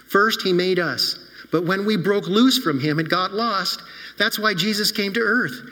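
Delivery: ordinary talk, nothing marked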